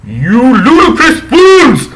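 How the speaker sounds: high, slow, loud outraged shout; angry shout. Acted. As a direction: thunder it like an old headmaster. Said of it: "He kept shouting at us angrily".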